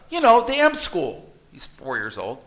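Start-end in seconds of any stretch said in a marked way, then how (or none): 0.1-1.3 put-on much higher, louder child insisting; little boy's voice. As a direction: say it like an impatient four-year-old who knows best. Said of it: "child insists on M school".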